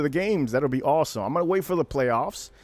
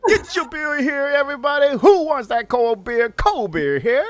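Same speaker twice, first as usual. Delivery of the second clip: loud, high hawker cry to the crowd; stadium beer vendor yelling; 12 semitones higher, 5 dB louder than usual. Retold or imitated. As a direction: bellow it like a beer vendor in the stands. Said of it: "I do scream out to everybody".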